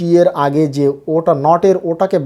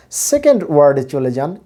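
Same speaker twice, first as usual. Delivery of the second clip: usual speaking voice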